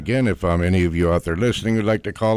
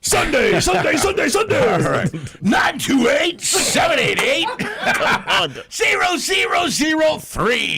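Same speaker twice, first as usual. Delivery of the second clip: booming, high-pitched announcer shout; monster truck ad. Acted. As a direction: yell it like a monster truck rally ad. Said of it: "Now do it in your monster truck voice".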